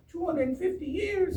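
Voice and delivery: very high, slow exaggerated squeak; mock amazement. Lecturer comparing two hundred fifty years with British history, mock amazed. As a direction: stretch it out high in pretend awe. Mocking how young the country is.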